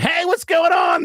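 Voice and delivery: put-on loud, high, drawn-out drunken greeting; hammered drunk guy. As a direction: shout it like a hammered stranger at a bar. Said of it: "he's hammered".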